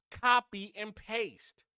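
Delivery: each word spelled out slowly; slow and deliberate. Shown as a demonstration. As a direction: drag it out to hammer the point home. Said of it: "copy and paste what we see Seattle doing".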